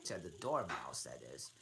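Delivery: usual speaking voice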